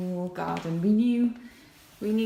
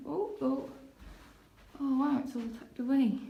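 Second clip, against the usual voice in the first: amazed; 7 semitones higher than usual. Real, not acted. Amazed at the huge top floor.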